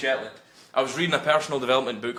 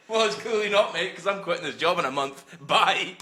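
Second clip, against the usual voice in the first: laughing through a cocky comeback; a higher, laughing mock retort; cheeky imagined comeback; 6 semitones higher than usual. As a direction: pretend telling the old man off, laughing.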